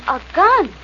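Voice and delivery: high, loud shocked gasp; shocked cry. Acted. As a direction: she sees the gun, shocked. Sees a gun, frightened.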